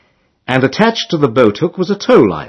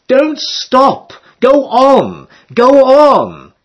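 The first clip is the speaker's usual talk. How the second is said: much higher, louder urgent shout; shouting to the girls; 12 semitones higher, 4 dB louder, much slower than usual. A narrator's reading. Rower urging the towing girls on, high urgent shout. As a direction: yell across the water to keep them going.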